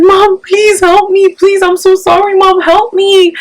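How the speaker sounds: high, slow, pained begging; imitating a pained plea. Daughter begging mom for help over the phone, pained and slow. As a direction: plead like a hurt child calling her mom. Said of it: "una voz como que, just so much pain".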